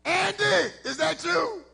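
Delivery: high-pitched woman. Retold or imitated. Woman in barbershop calling out to Andy, high-pitched. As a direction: call out his name in a high woman's voice. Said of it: "this high-pitched voice".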